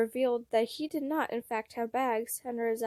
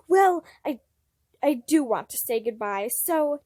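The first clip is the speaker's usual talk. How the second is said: flustered burst; 6 dB louder than usual. Acted. Roman flustered outburst.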